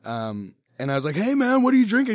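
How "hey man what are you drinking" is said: high, loud hey man; calling out cheerfully across the room; upbeat pitched-up greeting; friendly call. Retold or imitated. Greeting a guy at a bar.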